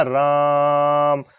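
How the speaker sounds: राम said slowly, the आ pulled out long; drawn-out vowel. Shown as a demonstration. Teacher demonstrating the long-sound mark by stretching राम slowly. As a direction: pull राम out like a chant. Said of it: "इतना लंबा खींच रहा हूं बीच में".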